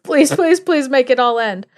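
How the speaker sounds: high, urgent mock plea; desperate pleading. Terrified family praying for it to end, desperate pleading.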